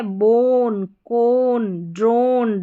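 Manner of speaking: teacher modelling the long O in बोन, कोन, ड्रोन; model word reading. Shown as a demonstration. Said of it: "जैसे यहाँ पर देखिए".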